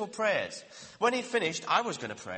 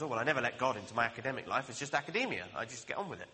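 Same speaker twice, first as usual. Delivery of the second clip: low, quick shrug of a voice; dismissive academic; 8 semitones lower, 3 dB quieter, faster than usual. Pretend. Preacher mimicking an academic who keeps God out, low and offhand. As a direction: brush off faith as mere academia, fast. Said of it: "Academics are like that sometimes".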